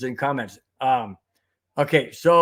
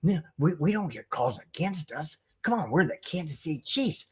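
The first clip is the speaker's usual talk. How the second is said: softer mocking crybaby whine; softer sneering crybaby impression; mock whiny Chiefs; 7 dB quieter than usual. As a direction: whine it like a spoiled team. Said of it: "'Cause they're like".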